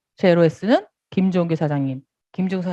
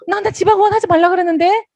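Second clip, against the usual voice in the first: higher, rising disbelief on 지방원; surprised partner; 10 semitones higher, 4 dB louder than usual. Retold or imitated. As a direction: answer with a surprised lift on 지방원. Other partner answers, surprised.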